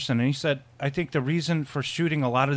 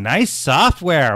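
robot quote; 3 semitones higher, 8 dB louder, much slower than usual. Retold or imitated. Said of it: "he's looking at Ali Sheedy and saying".

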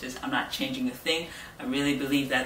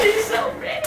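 high gushing squeal; excited fan squeal; squealing praise; 4 dB louder than usual. Real, not acted. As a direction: gush it out loud. Fangirling over the teaser.

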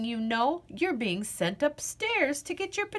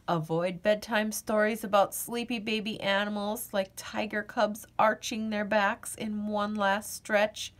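ordinary delivery

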